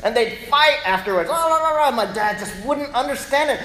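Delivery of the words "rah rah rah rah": high loud "rah rah" bickering; hammed-up squabble; mock quarrel noise. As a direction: imitate arguing gibberish. Imitating parents' fight.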